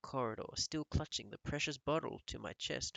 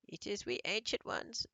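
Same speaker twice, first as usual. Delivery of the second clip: high, timid old witch, lightly voiced; a nervous old witch behind the door; meek old witch; 9 semitones higher than usual. A narrator's reading. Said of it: "said a meek voice".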